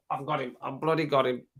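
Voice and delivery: ordinary delivery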